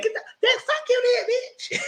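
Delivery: very high shrieking put-down; pissed-off Kiki. Acted. Kiki snapping at the boys who ignore her, shrieking high insult. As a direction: squeal the insult at them. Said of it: "She was pissed".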